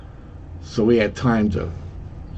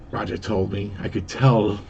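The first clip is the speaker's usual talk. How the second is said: ordinary delivery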